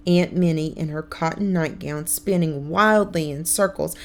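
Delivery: plain reading voice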